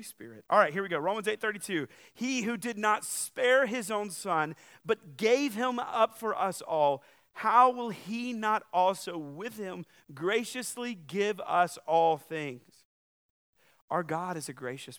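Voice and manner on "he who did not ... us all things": slow, loud, forceful reading of the verse; emphatic scripture reading. Acted. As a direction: proclaim each phrase slowly.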